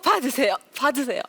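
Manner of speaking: loud repeated call. Shown as a demonstration. Lecturer throwing her heart to audience, loud calls. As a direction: toss each word out to the crowd. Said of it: "제 마음을 던져드릴게".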